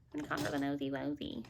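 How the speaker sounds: low, hushed goofy voice; silly put-on voice. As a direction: drop it low and soft for a cheeky repeat. Mock repeat of baby-talk phrase.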